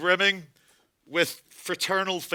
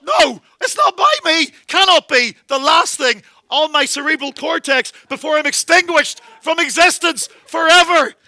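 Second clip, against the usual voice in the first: high, loud, slow wailing; hammed-up despair; 7 semitones higher, 9 dB louder than usual. Doomed passenger crying why me, wailing loud. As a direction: wail at fate in mock anguish.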